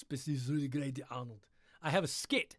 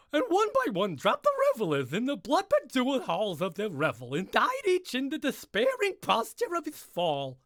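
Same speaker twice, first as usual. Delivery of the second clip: much higher, louder, dithery Mad Hatter voice; Ed Wynn as the Mad Hatter; 10 semitones higher, 10 dB louder than usual. Acted. Reading Poe's final lines to listeners as a high, loud Mad Hatter. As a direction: read it like a loopy, fluting hatter. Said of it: "Ed Wynn, the Mad Hatter".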